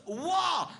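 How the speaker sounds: "what?" shouted high and loud with pure aggression; aggressive shout. Retold or imitated. The comic re-enacting his girlfriend's outraged shout. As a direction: hurl the "what?" like pure rage. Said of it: "Pure aggression, pure emotion".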